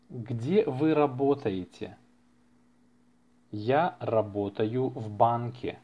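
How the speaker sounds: ordinary delivery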